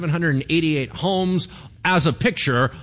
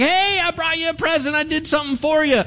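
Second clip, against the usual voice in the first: eager gift-giver; 5 dB louder than usual. Retold or imitated. Eager gift-giver, much higher.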